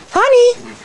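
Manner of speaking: one high call of the name; sharp high name call. Real, not acted. Calling dog by name.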